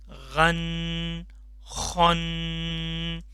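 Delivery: गन with voiced ghain, then खन with rough kha; contrast gain and kha. Shown as a demonstration. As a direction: keep गन and खन clearly apart. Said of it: "इनमें आपने फ़र्क करना है". (गन और खन का फ़र्क साफ़)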